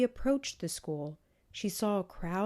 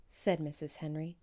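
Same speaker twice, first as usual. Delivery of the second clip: usual speaking voice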